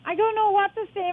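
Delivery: usual speaking voice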